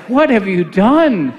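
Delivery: slow, drawn-out dramatic outcry; aghast, swooping up and down on each word; mock horror. Reacts to finding Alexa unplugged.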